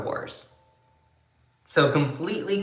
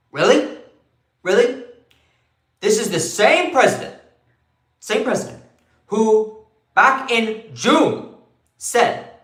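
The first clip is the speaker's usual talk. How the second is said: put-on higher, louder indignant old senator voice; Bernie Sanders impression; 4 semitones higher, 4 dB louder than usual. Host redoes the news bit in his Bernie voice. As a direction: rail at it like an outraged Bernie. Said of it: "Should I do that in my Bernie voice?".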